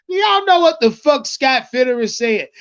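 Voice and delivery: normal talking voice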